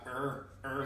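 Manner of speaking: "er" as a dull r-coloured vowel; closed ER sound. Shown as a demonstration. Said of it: "make it er".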